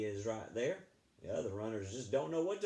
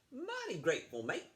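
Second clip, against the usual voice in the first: higher, bright thank-you as Josh; high cheerful thanks; 9 semitones higher than usual. Acted. Josh thanks Rancher Wadley for the Jeep, high and cheerful. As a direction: chirp your thanks up high.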